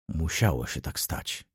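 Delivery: low, soft mutter; subdued, resigned murmur. A narrator's reading. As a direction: mutter it under your breath. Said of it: "mruknął".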